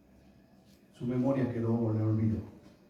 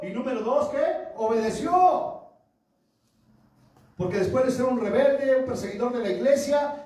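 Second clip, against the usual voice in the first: high, loud pulpit delivery; full-force preaching; 8 semitones higher, 4 dB louder than usual. Acted. Preacher urging hermanos to believe and obey, full-force preaching. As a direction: drive each point home to the congregation, pitched high.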